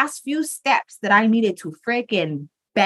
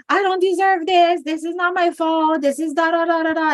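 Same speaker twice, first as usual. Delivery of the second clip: much higher, whiny mock sob; mock crying whine; 9 semitones higher than usual. Person snapped at by friends, mock crying high whine. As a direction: whine like a hurt victim. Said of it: "the other person is crying".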